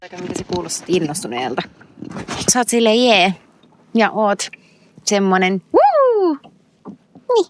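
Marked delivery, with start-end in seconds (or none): none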